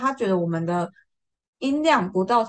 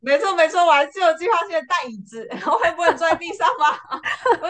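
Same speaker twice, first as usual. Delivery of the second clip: laughing excitedly; 7 semitones higher, 5 dB louder, faster than usual. Real, not acted. Laughing at her own planning.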